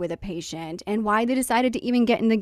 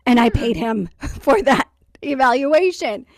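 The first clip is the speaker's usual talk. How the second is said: laughing; 3 semitones higher, 6 dB louder than usual. Real, not acted. Laughing at absurd paid evaluation.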